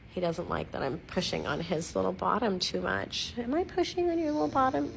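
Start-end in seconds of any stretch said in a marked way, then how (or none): 3.4-4.9 high, quick cooing question; pet baby-talk. Pretend. Owner teasing pug about his bottom, high baby-talk. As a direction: coo at the dog in a high voice.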